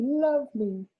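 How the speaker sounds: high squeaky repeat of the word lovely; squeaky mice echoing. A narrator's reading. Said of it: "they squeaked".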